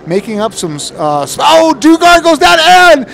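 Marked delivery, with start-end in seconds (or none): 1.4-3.1 much higher, louder alarmed call; excited. Real, not acted. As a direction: shout out the crash live. Rider crashes during the race.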